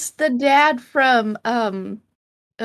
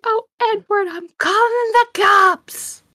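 high voice stretching into a slow cry; hammed-up high holler; mock shrill threat; 11 semitones higher, slower than usual. Neighbor threatening Edward, in a mock yell.

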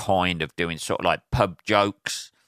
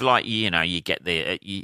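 ordinary delivery

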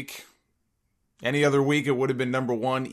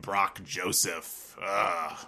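low raspy smoker croak; chain smoker voice; 6 semitones lower, 5 dB quieter, much slower than usual. Real, not acted. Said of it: "why do I sound like a chain smoker".

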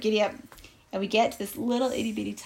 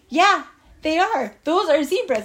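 higher, louder excited insistence; insistent; 6 semitones higher, 5 dB louder than usual. Acted. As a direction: insist to a doubting friend. Insisting to doubtful Frank.

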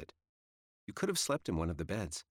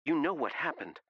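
higher, pointed question, falling at the end; accusatory, raised pitch; accusing question; 9 semitones higher than usual. A narrator's reading. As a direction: ask it as an accusation. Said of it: "More of an accusation than a question".

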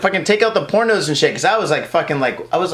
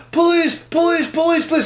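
retold shout, pitched up high; calling out; 10 semitones higher than usual. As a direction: shout for the police down the street. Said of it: "as I'm yelling".

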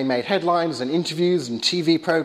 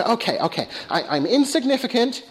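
neurotic ant rising higher, pausing before the punchline; Woody Allen ant; 8 semitones higher than usual. Acted. Little ant heads to work, higher neurotic resolve. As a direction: build from muttered acceptance to high defiance. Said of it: "little Ant Woody Allen goes off to work".

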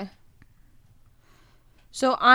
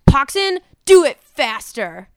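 louder, slow commanding push; bossy order; 6 semitones higher, 9 dB louder, slower than usual. Acted. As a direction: lean on the goblin to hurry. Boss orders goblin to hurry.

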